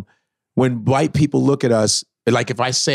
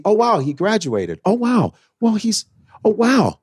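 high, breathy mock amazement, clearly higher; 7 semitones higher than usual. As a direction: mimic a condescending surprised praise.

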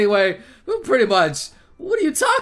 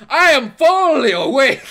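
louder, with FULLY leaned on; emphatic declaration; 2 semitones higher, 6 dB louder than usual. Real, not acted. Excited player telling viewers he's awake, emphatic declaration. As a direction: declare it loudly, stressing "fully".

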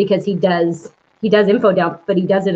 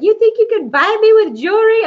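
mock indignant, high and loud; hammed-up offended girlfriend; 6 dB louder than usual. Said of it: "she's like".

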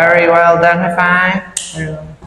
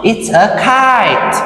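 slow modelling; 4 semitones higher, slower than usual. Shown as a demonstration. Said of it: "teacher models for child".

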